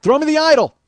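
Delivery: high, loud urgent yell; hammed-up adventure-film cry; mock movie shout. Movie character demanding the idol, shouting. Acting out Indiana Jones scene.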